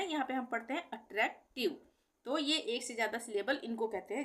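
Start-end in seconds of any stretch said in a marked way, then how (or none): 0.9-1.9 अट्रैक्टिव with a break before tiv; not in one flow. Shown as a demonstration. Teacher showing how we read it, अट्रैक्टिव broken apart. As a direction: read अट्रैक्टिव as two separate chunks. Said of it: "प्रवाह में नहीं हो रहा है ना".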